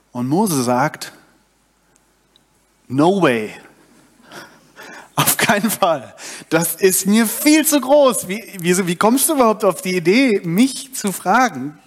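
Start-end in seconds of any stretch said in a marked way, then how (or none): 2.9-11.8 hoch und ungläubig protestieren; entsetzte Abwehr. Acted. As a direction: als wäre das völlig absurd. Mose wehrt sich gegen Gott. (Mose wehrt Gottes Auftrag ab, ungläubig protestierend)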